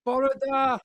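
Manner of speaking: loud, high cheery hello; excited greeting. Real, not acted. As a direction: call out a bright greeting. Greets in Welsh costume.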